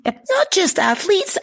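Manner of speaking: high, slow teasing voice; high mock voice. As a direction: repeat it in a silly high voice. Repeats with put-on voice.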